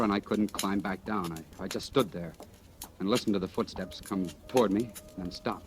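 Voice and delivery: soft, dropped, hushed telling; hushed recollection. Acted. Man confessing to lieutenant how he froze, hushed. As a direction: pull the voice down to a hush.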